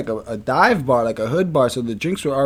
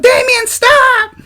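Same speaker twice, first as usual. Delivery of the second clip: very high, loud, slow mock yell; imitating a shrieked warning; retold scream; 8 dB louder, much slower than usual. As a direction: cry out like a scared friend far off. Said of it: "All I hear is Ange in the background".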